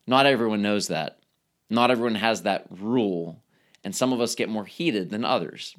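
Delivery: ordinary talk, nothing marked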